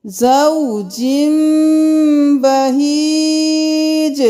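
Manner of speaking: زوجین بہیج with the nasal m held long; recitation with meem. Shown as a demonstration. Teacher shows how to recite it. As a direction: draw out زوجین بہیج with a humming m. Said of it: "جم کریں گے".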